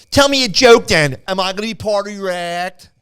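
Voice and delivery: loud, high Philadelphia hairdresser voice; thick Philly accent. Retold or imitated. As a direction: keep the heavy Philly accent going. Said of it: "Imagine my accent on steroids".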